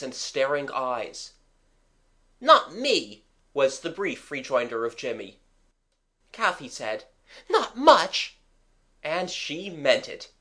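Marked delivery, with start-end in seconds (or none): 2.4-3.2 firm refusal. A narrator's reading. Jimmy refusing in fear. 7.5-8.4 high, loud flat no; emphatic refusal. A narrator's reading. Kathy refusing in fear.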